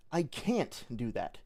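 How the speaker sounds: "can't" stressed and drawn out, falling end; slow, with emphasis on "can't". Shown as a demonstration. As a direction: lean hard on "can't". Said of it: "I put an emphasis on a different word".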